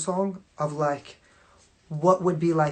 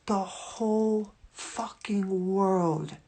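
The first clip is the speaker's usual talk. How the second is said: slow, hushed whisper; awed whisper; 3 semitones higher, much slower than usual. Real, not acted. Telling her audience the whole world watches, in a slow awed whisper. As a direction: breathe it out in awe, very slowly.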